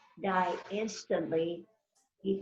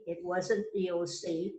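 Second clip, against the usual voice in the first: ordinary talk, nothing marked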